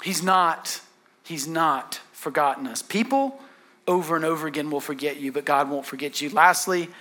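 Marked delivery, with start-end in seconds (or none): none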